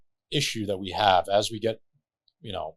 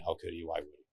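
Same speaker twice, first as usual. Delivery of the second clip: softer, trailing away to a hush; storyteller echoing the widow's question, fading; 3 semitones lower, 8 dB quieter, faster than usual. Retold or imitated. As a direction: let the questions die out quietly. Narrator echoes the questions fading.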